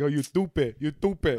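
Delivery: cousin's teasing imitation with lisped "stupid"; mocking lisp. Cousin teasing him about his speech, mock lisp on "stupid". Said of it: "cousin Mike used to be like".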